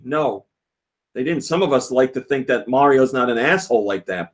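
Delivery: usual speaking voice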